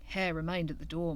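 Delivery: ordinary delivery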